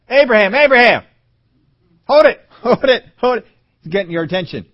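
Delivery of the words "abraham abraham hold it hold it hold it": high, loud urgent call to stop; urgent shout. Acted. God shouting to stop Abraham's knife, urgent and loud. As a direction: shout to stop him before it's too late.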